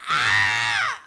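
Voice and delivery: loud scream of pain in labour. Acted. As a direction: scream as the contraction hits.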